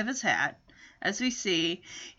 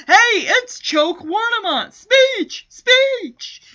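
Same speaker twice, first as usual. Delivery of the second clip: high, raised taunting chant; heckler calling out; 8 semitones higher, 5 dB louder, slower than usual. Acted. Hecklers shout at Henry through the window, jeering. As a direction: call out mockingly from outside a window. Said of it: "out the window we hear".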